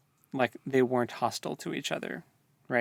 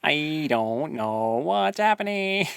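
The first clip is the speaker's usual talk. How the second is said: slow, dazed and bewildered; dazed mock confusion; 4 semitones higher, slower than usual. Friend mocking her bewildered reading voice. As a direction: sound lost in a fog of names. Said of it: "internet dial-up sounds".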